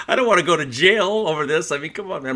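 backing out fast, voice jumping up; alarmed protest. Retold or imitated. Telling the bar story, his own reply to Mark in alarmed high pitch. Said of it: "I go".

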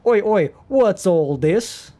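drawn-out mock British challenge; British accent. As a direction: butt in like a British copper. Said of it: "British accent request".

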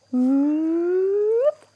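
long whoop gliding steadily upward; high, drawn-out sound effect; rising opening noise. Pretend. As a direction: slide the voice up like a lid lifting. Mimes chest lid opening.

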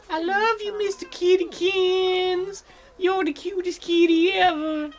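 very high, drawn-out cutesy coo; syrupy falsetto for a kitten; kitty baby talk. Pretend. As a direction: squeak lovingly at a pet. Baby-talking to a game kitty.

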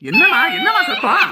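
drawn-out, very high, loud demand; startled shout. Acted. Man checking on the noise next door, startled shout. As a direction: call out loudly and slowly over the crying.

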